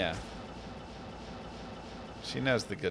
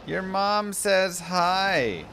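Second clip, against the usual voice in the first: high slow voice; 9 semitones higher, 4 dB louder, much slower than usual. Acted. Streamer reads chat greeting slowly.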